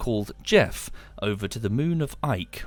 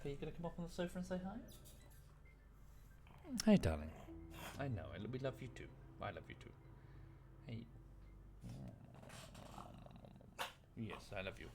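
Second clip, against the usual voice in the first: soft high cooing to the dog; 5 semitones higher, 18 dB quieter, faster than usual. Real, not acted. Talking to her dog, soft tender cooing. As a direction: coo lovingly at a pet, voice gently lifted.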